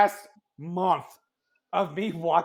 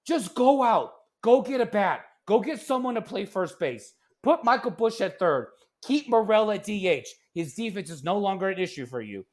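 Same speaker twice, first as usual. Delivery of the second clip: ordinary talk, nothing marked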